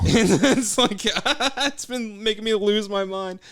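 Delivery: can't stop laughing as you tell it; real laughter. Real, not acted.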